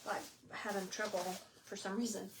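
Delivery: short of air, words fading; winded, barely pushing the words out; out of breath. Real, not acted. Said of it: "I don't know why I'm out of breath".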